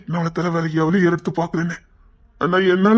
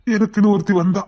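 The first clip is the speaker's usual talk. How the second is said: usual speaking voice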